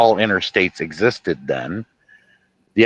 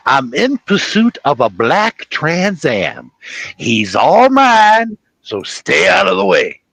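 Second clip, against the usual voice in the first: blustering sheriff, key words stretched and pitched up; Jackie Gleason; 6 semitones higher, slower than usual. Acted. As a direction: declare the chase slowly, self-importantly. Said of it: "I'm pretty good at doing Jackie Gleason".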